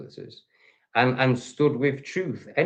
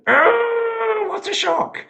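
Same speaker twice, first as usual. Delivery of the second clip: much higher, loud sarcastic gasp of shock; mock surprise; 5 dB louder, much slower than usual. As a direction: fake astonishment dripping with sarcasm. Sarcastic reply to a threat.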